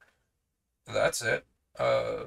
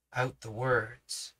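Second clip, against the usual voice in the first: plain reading voice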